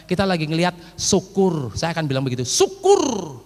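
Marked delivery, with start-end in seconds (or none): 2.4-3.4 high, drawn-out taunt; mocking "serves you right". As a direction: jeer it slowly with relish, acting callous. Memperagakan ejekan kepada anak lapar. (ejekan pura-pura, tinggi dan dipanjangkan)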